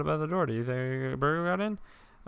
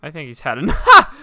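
louder, laughing through it; 16 dB louder than usual. Real, not acted. Player laughing at his own joke line. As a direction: crack up mid-line. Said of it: "I put my gun at him and I was like".

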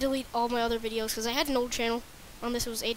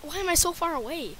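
ordinary talk, nothing marked

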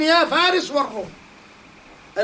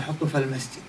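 drops much lower and softer to stress the answer; low, soft repeat; 12 semitones lower, 10 dB quieter, faster than usual. Shown as a demonstration. Preacher repeats Umar's order to the crowd, hushed and low. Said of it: "آه، وش قال؟".

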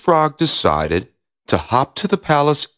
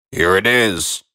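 frog voice; 4 semitones lower than usual. Acted. Frog returns ball.

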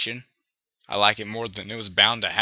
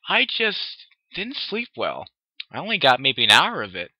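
acted tiredness, pitched up; tired Twilight; 5 semitones higher, 5 dB louder than usual. A narrator's reading. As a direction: draw it out sleepily in a raised voice. Exhausted Twilight answers after yawning.

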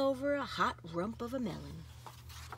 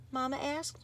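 usual speaking voice

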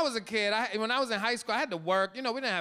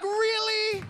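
incredulous; 13 semitones higher than usual. Retold or imitated. Dad's disbelief at son's act.

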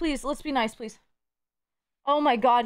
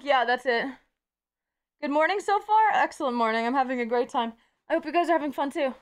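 ordinary delivery

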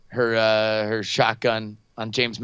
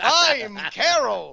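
high, slow mock character voice; another personality; 10 semitones higher, much slower than usual. Podcaster plays old woman's other personality, high hammed-up voice. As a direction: announce the new persona in a drawn-out high voice. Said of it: "That was my other personality".

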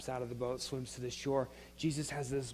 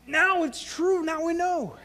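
excited realization; 11 semitones higher, 5 dB louder, slower than usual. Retold or imitated. Disciples realizing Jesus is risen.

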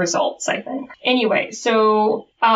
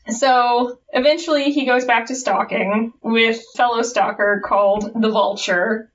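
ordinary talk, nothing marked

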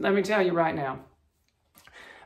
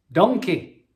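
loud, hammed-up Scottish "Donkey." shout imitating Shrek; Scottish brogue attempt; 2 semitones higher, 7 dB louder than usual. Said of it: "I wish I could do a Scottish brogue better".

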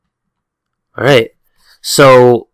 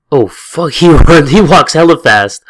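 ordinary delivery

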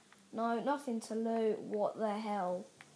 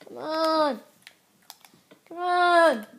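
higher, loud hype shouts; excited cheering; 6 semitones higher, 12 dB louder than usual. Real, not acted. Gamer finding loot, shouting with excitement. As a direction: cheer it on like a win.

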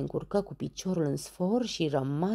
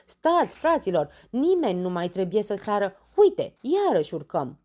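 loud, high, urgent halt; shouting; 5 semitones higher, 7 dB louder than usual. Acted. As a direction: call out to stop them. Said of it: "și strigă". (strigăt tare: opriți-vă)

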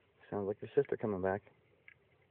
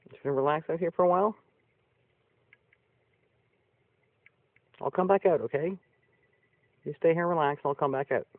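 high and louder, soothing a pet; high loud pet talk; 7 semitones higher, 6 dB louder than usual. Real, not acted. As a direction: promise the animal you'll be back, high voice. Talking to her animal.

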